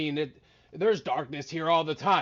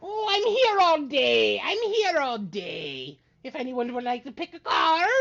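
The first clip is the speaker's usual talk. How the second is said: showman wizard; 8 semitones higher, 6 dB louder than usual. Acted.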